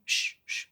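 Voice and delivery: soft, breath-only shushes; soothing shushing. Acted. Daughter quieting her sobbing father, gentle shushing. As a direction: hush him gently.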